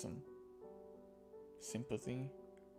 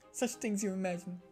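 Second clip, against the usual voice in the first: higher, louder character voice; story character's reply; 5 semitones higher, 4 dB louder than usual. Acted. Friend answering musings about being cured, in character voice. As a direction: raise the voice into a lighter character. Acted line of game dialogue.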